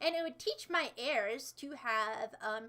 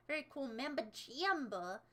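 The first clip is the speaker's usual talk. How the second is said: ordinary talk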